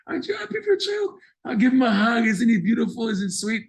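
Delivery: put-on gushing over child. Coaxing parent to see child.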